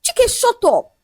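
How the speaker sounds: screamed. A narrator's reading. Fiancée covering her ears at his excuses, screamed. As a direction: send the pitch up to cut him off. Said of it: "Ola screamed".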